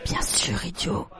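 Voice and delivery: hushed, voiceless whisper; whispered reply. A narrator's reading. Boy leaning to friend near the captain, whispering. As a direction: whisper back so nobody overhears. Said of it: "se pencha vers Pedro".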